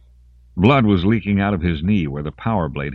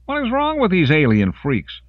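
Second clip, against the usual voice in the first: angry shout; 13 semitones higher, 3 dB louder than usual. Acted. Soldier shouting under fire.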